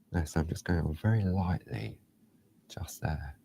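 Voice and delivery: hushed, dropping to a whisper. Real, not acted. Working very lightly on the owl, hushed. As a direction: hush down as the pencil touches. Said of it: "I don't know why I'm whispering".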